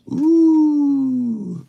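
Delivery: a long, high tempted ooh; intrigued ooh. Real, not acted. Reacts to bald challenge.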